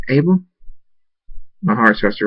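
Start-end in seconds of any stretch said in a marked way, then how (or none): 0.1-0.4 uncertain call. A narrator's reading. No answer from hidden Abel.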